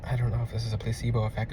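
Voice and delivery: soft, low, turning the doubt over; soft puzzled repetition. Retold or imitated. Client unsure whether crystals work, soft puzzled murmur. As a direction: mutter the same doubt again and again.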